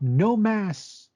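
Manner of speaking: high-pitched imitated kid's yell; proud child's yell. Young child to store worker about masks, proud imitated yell. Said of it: "child just proudly yells out".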